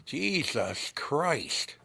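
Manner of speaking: "Jesus Christ" with slushy lateral s sounds, drawn out slowly; lateral lisp. Shown as a demonstration. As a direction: hiss the s in "Jesus Christ" sideways through the teeth. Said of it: "He had this, the lateral S".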